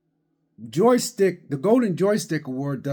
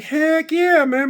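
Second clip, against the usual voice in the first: excited; 12 semitones higher, 6 dB louder, slower than usual. Retold or imitated. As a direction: hype it up, high and loud. Retold own excited reaction.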